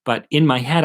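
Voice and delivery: usual speaking voice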